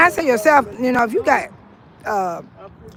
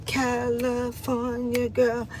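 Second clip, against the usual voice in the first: very slow, softer, savoring the words; drawn-out softer; 8 dB quieter, much slower than usual. Real, not acted. Woman proudly claiming her roots, slow soft drawn-out repeat. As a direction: let it linger dreamily. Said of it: "Yes, I'm from California".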